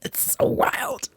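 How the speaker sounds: whisper. Real, not acted. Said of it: "whispering through laughs".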